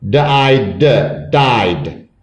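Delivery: D, I, d, then blended died; sounded out. Shown as a demonstration. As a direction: separate each sound, ending died on a voiced d. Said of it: "so that D sounds like D".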